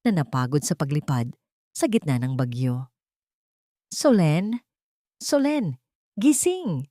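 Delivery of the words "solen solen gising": takot na tawag. Acted. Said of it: "Tawag ni Festo".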